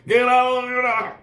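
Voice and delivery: high, slow furious yell from the cab; road rage yelling. Retold or imitated. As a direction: scream it at another driver.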